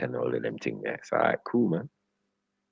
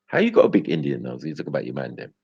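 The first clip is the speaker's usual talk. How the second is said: ordinary talk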